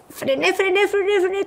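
skidding to a stop; urgent braking. Retold or imitated. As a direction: shout it as you skid. Slamming the brakes.

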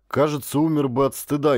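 usual speaking voice

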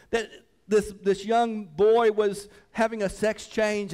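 pressing hard on the congregation; fervent preaching. Real, not acted. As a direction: preach it loud and urgent. Preaches with full force.

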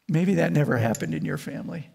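ordinary delivery

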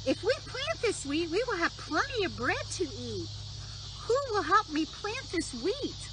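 high bright hen voice; little red hen voice. Acted. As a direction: voice the hen calling out. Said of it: "She called out".

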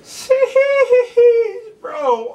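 very high, loud cry of amazement; a real squeal of excitement; shrieking in hype. Real, not acted. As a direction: scream it like the beat just dropped on you. Screams at the verse.

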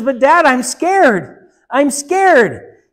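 slow, high, loud and fearful; scared girl. Acted. Daughter telling her father she is afraid to jump, slow and scared. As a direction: draw out the fear word by word.

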